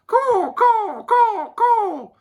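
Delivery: very high squawking caws; panicked puffin cries. A narrator's reading. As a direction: squawk like frightened birds. Startled puffins cry out.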